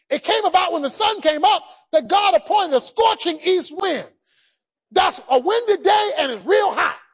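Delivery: usual speaking voice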